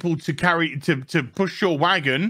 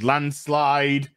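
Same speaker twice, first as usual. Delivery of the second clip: normal talking voice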